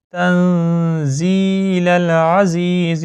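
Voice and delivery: slow melodic tajweed chant; Quran recitation. Shown as a demonstration. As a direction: recite it slowly with ghunna. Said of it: "مزید ایک دفعہ revise کر لیں". (قرآن کی تجوید کے ساتھ آہستہ تلاوت)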